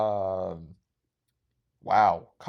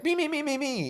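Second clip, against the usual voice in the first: put-on much higher, childlike eager me-me-me; eager volunteering. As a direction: beg to be picked, pitch way up.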